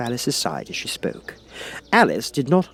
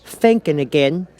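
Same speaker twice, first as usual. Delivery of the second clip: high, louder prying question; Duchess, louder, slow; 6 semitones higher, 5 dB louder, slower than usual. A narrator's reading. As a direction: poke at her. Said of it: "the Duchess asked".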